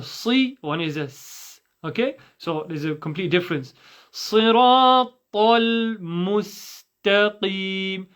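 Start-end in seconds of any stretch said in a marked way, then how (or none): 4.1-8.1 slow teaching chant with held vowels; measured, drawn-out recitation; Quranic recitation. Shown as a demonstration. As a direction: recite each syllable distinctly.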